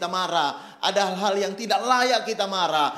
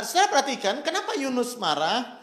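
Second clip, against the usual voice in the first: ordinary delivery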